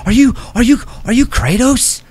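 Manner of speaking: put-on high, loud child voices, breathless; excited kids. Little kids mob the hero they recognize, excited. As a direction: gasp as if meeting a hero.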